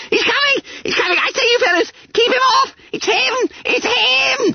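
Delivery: very high, frantic squeal; continued yelling. A narrator's reading. Lone boy still raising the alarm as the master arrives, yelling. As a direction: keep squealing in blind panic. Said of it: "Only Bunter continued to yell".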